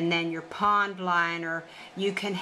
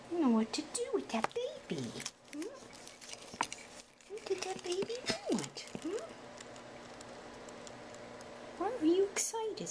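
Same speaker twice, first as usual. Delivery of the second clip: cooing pet talk; 9 semitones higher, 10 dB quieter than usual. Real, not acted. Owner coos to the wound-up pet, high baby talk.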